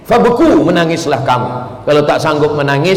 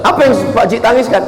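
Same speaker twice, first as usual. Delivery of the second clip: puzzled ustaz; 8 semitones higher, faster than usual. Retold or imitated. Ustaz asks again.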